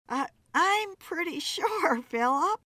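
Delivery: high, giggly woman's voice; laughing. A narrator's reading. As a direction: let a laugh ripple through the words. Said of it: "Beth laughed".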